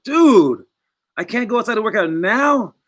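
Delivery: can't believe fate did this again; exasperated disbelief. Retold or imitated. Player complaining to fate about the fires, exasperated disbelief.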